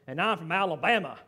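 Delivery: badly faked Australian introduction; horrible Australian accent. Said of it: "forgive my horrible Australian accent this morning".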